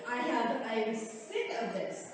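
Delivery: low, soft, slowed and seething; quiet anger. Real, not acted. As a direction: hold the anger down under the breath. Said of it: "I'm getting mad".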